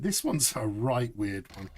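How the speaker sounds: normal talking voice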